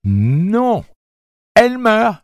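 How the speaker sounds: high, loud shriek of his name; piercing panicked yelp; yelping cry. Acted. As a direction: stop him, screaming. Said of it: "glapit sa mère".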